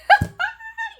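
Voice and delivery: squealing real laugh; high burst of laughter. Real, not acted. Cracking up at her own story, a high laugh. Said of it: "I am laughing".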